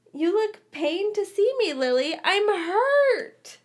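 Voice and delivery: high, loud theatrical hurt; mock sadness. A narrator's reading. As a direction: play wounded for a laugh. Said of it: "James said in mock sadness".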